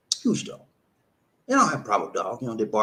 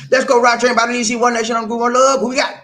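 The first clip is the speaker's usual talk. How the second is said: loud, high, quick radio shout-out; hype show announcer; 6 semitones higher, 8 dB louder, faster than usual. Acted. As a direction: fire up the show for the next caller. Announces the next caller on show.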